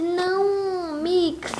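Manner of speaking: high, drawn-out imitation of pleading; acted pleading. Friend protests at the goodbye, acted pleading.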